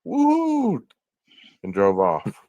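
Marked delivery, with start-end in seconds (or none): 0.0-0.9 high-pitched "woo" whoop; re-enacted celebratory whoop, high but not full volume; imitated victory yell. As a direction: let out a high, held mock whoop. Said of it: "probably yelled out loud".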